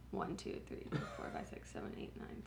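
counting under her breath in a whisper. Real, not acted. Counting the snack packs for the camera in a whisper. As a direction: hush it, just breathe the numbers.

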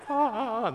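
high surprised gasp; a mock high gasp of surprise. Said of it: "Dean's going".

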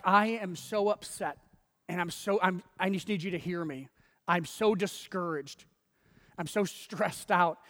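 ordinary talk

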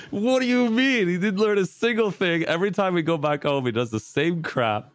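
incredulous retold protest; indignant protest.

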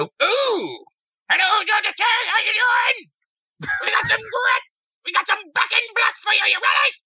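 [name] impression. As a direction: screech it like a hard rock frontman. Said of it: "a fairly decent [name]".

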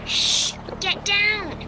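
urgent warning. Acted. Becca spotting danger, urgently telling friends to hide. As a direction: get your friend down fast.

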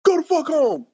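angry yelling. Retold or imitated. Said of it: "and it was like".

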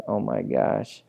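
low, hushed retold reaction; quiet disbelief. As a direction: say it softly, as a stunned cop. Stunned cop reacts to the conversion.

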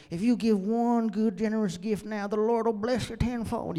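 high-pitched TV preacher pitch in a bad accent; hammed-up televangelist promising a blessing. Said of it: "Sorry, that's a bad accent".

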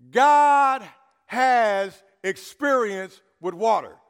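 "God" called out loud, then slow; emphatic. Shown as a demonstration. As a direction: hammer the point home slowly. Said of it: "if you don't remember nothing else".

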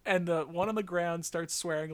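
normal talking voice

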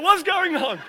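high, loud, laughing question. Retold or imitated. Fire chief asking what happens, retold laughing high. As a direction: ask it while laughing. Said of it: "And he's like".

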